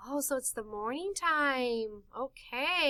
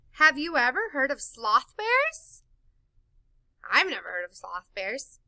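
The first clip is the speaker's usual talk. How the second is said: usual speaking voice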